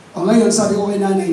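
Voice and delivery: ordinary talk, nothing marked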